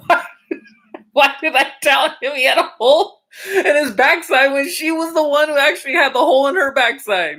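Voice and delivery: high pitched, laughing through the words; can barely get it out for laughing. Real, not acted. Teacher telling viewers the karma punchline, laughing.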